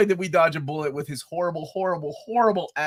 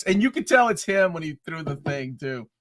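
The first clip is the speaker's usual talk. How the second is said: normal talking voice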